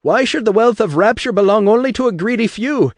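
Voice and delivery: highest, loudest brogue climax; rising Irish roar. Acted. Atlas climaxes his speech in a loud Irish roar. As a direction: build to a booming shout. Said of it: "His voice rose and rose again".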